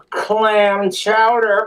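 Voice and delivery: drawn-out slow delivery; slow punchline. Acted. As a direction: savor the punchline slowly.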